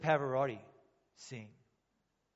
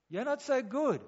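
wry put-down; 9 semitones higher, 5 dB louder than usual. Retold or imitated. Mock self-judgement aside.

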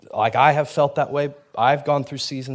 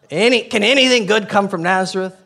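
scoffing; 7 semitones higher, 7 dB louder than usual. Retold or imitated. Nathaniel scoffs at Philip.

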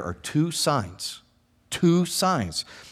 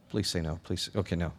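low, soft aside; hushed pleading; 8 semitones lower, 12 dB quieter than usual. Real, not acted. Preacher begging congregation for the right answer, hushed. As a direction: drop right down and beg under the breath.

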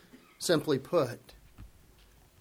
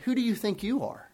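quick, sneering "who do you think you are"; scornful challenge; 4 semitones higher, 8 dB quieter, faster than usual. Retold or imitated. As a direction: toss it off with contempt. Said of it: "we might say it this way".

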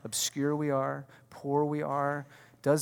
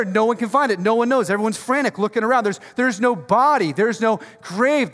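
loud, driving sermon build; full-force preaching; 7 semitones higher, 8 dB louder than usual. Acted. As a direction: preach it out, louder and higher.

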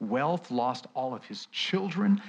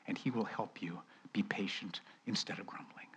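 hushed, breathy promise; whispered reassurance; 5 semitones lower, 12 dB quieter than usual. Acted. Preacher whispering comfort to the listeners.

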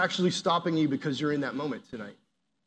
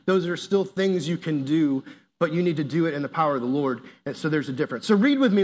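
usual speaking voice

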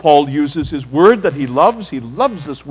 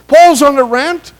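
raised, louder mocking accusation; higher, louder mock complaint; mock critic's accusation; 8 semitones higher, 7 dB louder than usual. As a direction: mimic a critic ranting about Paul. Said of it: "they say".

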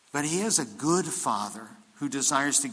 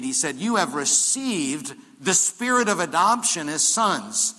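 loud, rising preacher's build; full-force preaching; 4 semitones higher, 6 dB louder than usual. Acted. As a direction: drive it home to the congregation.

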